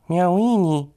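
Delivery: high, loud drawn-out meow; meowing cat. A narrator's reading. Tomcat answers what he hears at the tub, meowing. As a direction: mew the word like a cat. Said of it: "mauzt Bonifaz".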